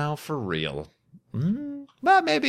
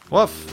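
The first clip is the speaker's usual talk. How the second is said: mock dog bark; 11 semitones higher, 4 dB louder than usual. Imitating the dog near a spawner.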